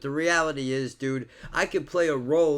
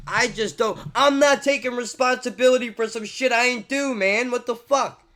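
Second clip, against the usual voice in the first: higher and louder, furious; heated rant; 9 semitones higher, 5 dB louder than usual. Real, not acted. Vlogger refuses blame for history, angry and loud. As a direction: refuse the blame, all fired up.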